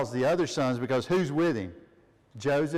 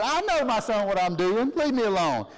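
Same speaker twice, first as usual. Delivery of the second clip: put-on raised, insistent old father brushing off his son; acted stubborn father; firm refusal; 6 semitones higher, 3 dB louder than usual. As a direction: push back, high and emphatic. Acting Jacob refusing his son.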